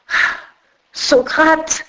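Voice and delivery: half-choked cry of a name, voice cracking; voice breaking with emotion. Acted. Old philosopher recognising his former pupil, crying out with breaking voice. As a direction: let the name break out of you, overcome. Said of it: "D'une voix brisée par l'émotion, il s'écria".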